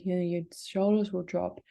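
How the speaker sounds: ordinary talk, nothing marked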